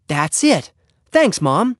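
high, pleased exclamation; delighted reply. Acted. Boy thanks his mom for the word, high and pleased. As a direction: jump up in pitch as the word clicks.

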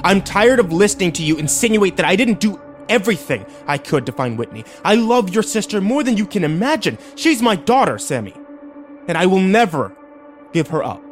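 high, impassioned angry rebuke; indignant father defending himself; snapping angrily. A narrator's reading. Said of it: "he snapped with more force than I'd ever heard".

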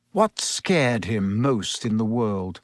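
ordinary delivery, nothing marked

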